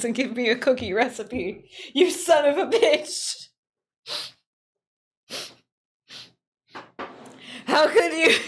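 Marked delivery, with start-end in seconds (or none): 1.9-8.3 high laughing outrage; hammed-up betrayed cry through laughter; mock betrayal. Streamer mock-accusing the AI while laughing.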